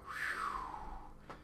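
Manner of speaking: acted wordless whew; relieved exhale. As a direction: blow out a long breath in mock relief. Imitates relief at the lighter charge.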